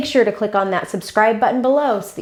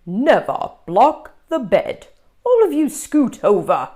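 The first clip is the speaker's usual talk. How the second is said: louder, bossy monster teacher; instructing monster; 4 semitones higher, 6 dB louder than usual. Acted. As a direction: give the order firmly. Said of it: "the yellow one instructed".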